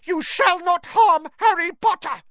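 very high, loud elf shout; defiant high-pitched elf; Dobby shouting. A narrator's reading. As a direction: shout a warning in a high creature voice. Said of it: "But Dobby shouted".

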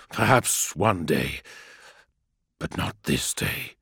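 low, fading whisper; defiant despite the wound; whispery telepathic voice. A narrator's reading. Wounded Emperor answers the demon.

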